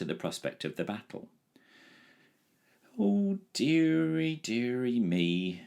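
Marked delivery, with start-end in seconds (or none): 3.0-5.6 slow, high, drawn-out moan. A narrator's reading. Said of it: "moaned the dragon".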